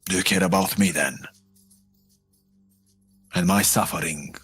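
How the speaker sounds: usual speaking voice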